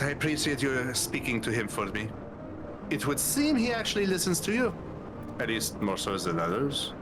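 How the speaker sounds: ordinary delivery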